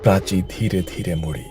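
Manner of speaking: plain reading voice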